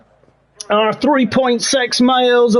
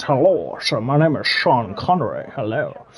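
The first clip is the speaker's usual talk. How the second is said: a lightly done, low Scottish accent impression; low, soft Sean Connery Scottish accent; mock Scottish accent greeting for a chat viewer; 7 semitones lower, 5 dB quieter than usual. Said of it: "says hello in his Scottish accent".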